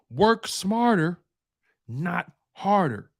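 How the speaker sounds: slow, deliberate motto; slow emphatic. Shown as a demonstration. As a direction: work smarter, not harder, said very slowly and drawn out.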